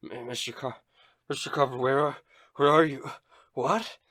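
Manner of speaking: high, rising frightened stammer; panicked confusion. Acted. Halfling waking blind in Carver's arms, high frightened stammer. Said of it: "he begins to sob".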